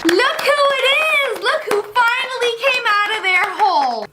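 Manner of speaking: high mocking welcome; teasing family. Family greets kid coming downstairs, high teasing call.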